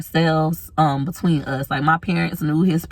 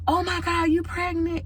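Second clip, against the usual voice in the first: very high, softer mock gasp; shocked fan; 12 semitones higher, 4 dB quieter than usual. People in public spotting her bump, very high surprised. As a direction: squeal it in disbelief. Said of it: "they're like".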